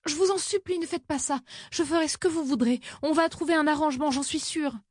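voix haute et implorante; supplie, affolée, dans l'aigu; supplication. A narrator's reading. Je vous en supplie. (supplication affolée, voix aiguë)

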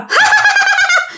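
very high shrieking laugh; creepy ghost cackle. Acted. Ghost gloating to the master, high creepy cackle. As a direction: cackle eerily at the top of your voice. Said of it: "Cười thấy ghê lắm".

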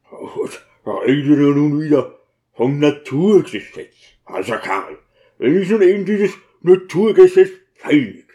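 gruff character voice, rising in protest; exasperated, grieving deacon; tormented deacon, irritated. Acted. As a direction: argue back out of grief.

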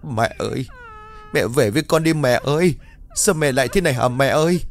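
kneel and wail for an audience; hammed-up sobbing lament; crying. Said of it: "quỳ rạp xuống rồi khóc".

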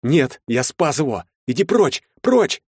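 rising from firm to high shout; escalating frantic refusal; desperate defiance. A narrator's reading. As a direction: drive Death away, building. Narrator defies Death, voice climbing.

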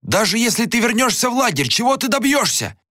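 fast, very high urgent argument; frantic high-pitched remonstrance; agitated insistence. A narrator's reading. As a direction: plead rapidly, refusing to let it go. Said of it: "не успокаивался Анатан".

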